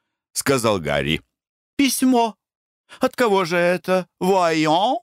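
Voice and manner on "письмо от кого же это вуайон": high, surprised lady's voice; surprised question. A narrator's reading. Lady surprised by an unexpected letter, high. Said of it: "Lady Clavering surprised by letter".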